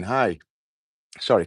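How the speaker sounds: usual speaking voice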